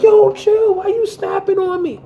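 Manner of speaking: high, loud mock whine; hammed-up defensive boyfriend. Boyfriend pushing back after she hits him, high mocking whine. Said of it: "he like".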